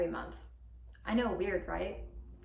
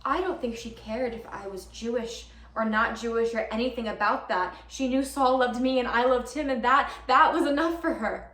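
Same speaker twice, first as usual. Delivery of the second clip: excited conviction; 3 semitones higher, 6 dB louder than usual. Acted. Girlfriend realizing Bubby accepted her, building excitement. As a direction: let certainty swell into a loud finish.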